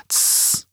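long voiceless hush; sharp-breathed "tsss" with no voice; shushing. A narrator's reading. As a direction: hiss a soft shush. Apparition hushes Foyle.

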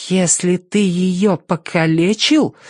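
slow, high-pitched warning; menacing girl, slow and high; threatening. A narrator's reading. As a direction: threaten slowly, stretching each word. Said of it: "с угрозой в голосе начала она".

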